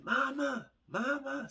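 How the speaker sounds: put-on high, wailing little girl calling mama; high child wail for mama; child screaming mama. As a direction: cry out for your mother like a small child. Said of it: "the little girl starts screaming".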